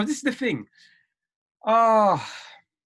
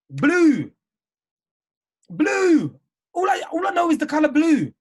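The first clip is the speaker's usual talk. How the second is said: excited; 7 semitones higher, 6 dB louder than usual. Real, not acted. Celebrating blue.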